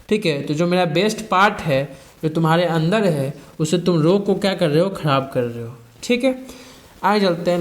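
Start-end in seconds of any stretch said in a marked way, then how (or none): none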